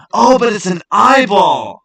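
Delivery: sudden high, loud gasp of realization; surprised discovery. Real, not acted. Streamer spotting the eyeball in the concept image, loud surprised exclaim. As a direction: blurt it out as the surprise hits.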